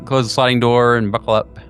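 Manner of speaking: ordinary talk, nothing marked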